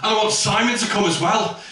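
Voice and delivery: high, impassioned plea to a mate; bold heartfelt outburst. Pretend. Man finally opening up to his best friend, hammed-up passionate plea. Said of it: "I wish I had the balls to say that".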